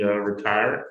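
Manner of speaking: ordinary delivery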